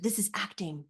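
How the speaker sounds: mock acting teacher. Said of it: "the teacher teaching the students how to act".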